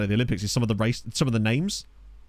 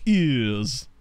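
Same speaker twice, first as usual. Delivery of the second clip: "Ears" in a posh English accent. Shown as a demonstration. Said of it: "Just say it like a posh bloke".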